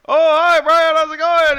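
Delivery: very high, loud, sing-song greeting; cheery Elmer voice. Retold or imitated. Elmer greeting the dying Brian, squeaky and cheery. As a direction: chirp it like an oblivious cartoon pal. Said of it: "He's like".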